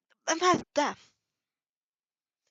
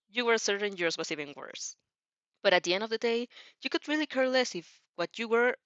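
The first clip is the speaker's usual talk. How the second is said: usual speaking voice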